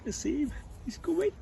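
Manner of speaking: rising with excitement; excited. Acted. Zacchaeus watching Jesus approach, getting excited.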